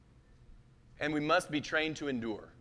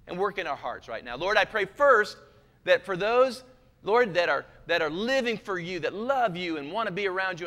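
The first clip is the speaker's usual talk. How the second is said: fervent pleading prayer, loud in waves; pleading preacher altar call; 3 semitones higher, 5 dB louder than usual. Acted. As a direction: pray over the room, pleading.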